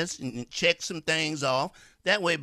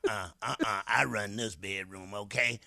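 lower, growled commanding bedroom voice; imitating a domineering woman; 6 semitones lower, 4 dB quieter than usual. The woman bossing her young lover, low and commanding. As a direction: boss him around in a low voice. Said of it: "she sound like that".

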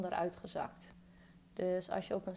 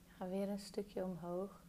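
calm meditation guide, long pauses between phrases; unhurried body-scan guidance, settling softer as it goes; guided meditation; 10 dB quieter than usual. Real, not acted. Guiding a listener through a body scan, calm meditation voice.

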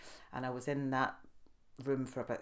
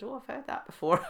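laughing; 9 semitones higher than usual. Retold or imitated. Laughing retort to doctor.

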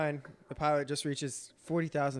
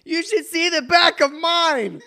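laughing; 13 semitones higher, 12 dB louder, slower than usual. Retold or imitated. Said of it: "a passenger way in the back said".

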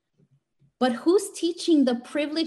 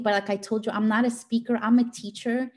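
usual speaking voice

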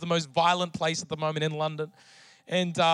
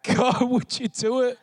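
choking up on a higher pleading voice; real breaking down; 4 semitones higher than usual. Real, not acted. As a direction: fight tears through halting, pleading words. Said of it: "every single time I talk about it, I just break down".

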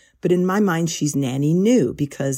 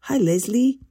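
high-pitched mock Australian grandma greeting; soothing Aussie grandmother; 9 semitones higher than usual. Host reading a gardener's email aloud, imitating her Aussie grandmother accent. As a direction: put on a sweet Aussie granny accent, pitched up. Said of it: "try to imagine a very soothing Aussie grandmother accent".

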